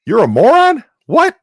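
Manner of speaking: very high, loud mock disbelief; retold shriek of confusion; incredulous shouting. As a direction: yell a baffled question over noise. Mishearing him again in loud bar.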